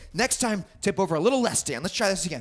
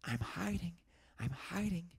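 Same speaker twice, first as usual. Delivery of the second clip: quiet singsong; 4 semitones lower, 14 dB quieter than usual. Acted. Hiding song softly.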